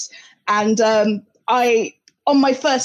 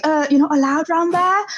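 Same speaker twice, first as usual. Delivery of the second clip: imitated London kid, higher pitched; South East London accent; 4 semitones higher than usual. Local kid warning a newcomer, imitated South East London accent. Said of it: "the day I dropped my T's".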